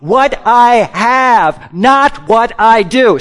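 slow, stressing "I have" against "not what I do"; preacher leads the congregation in a slow, emphatic refrain; slow call to repeat. Shown as a demonstration. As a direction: hit "not", hold each word so they can follow. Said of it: "Say that with me".

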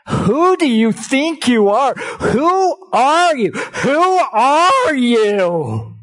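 loud, high, slow and drawn out; indignant crowd demanding who are you; emphatic. Acted. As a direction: demand it slowly, rising in outrage. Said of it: "that is said hashtag emphatically".

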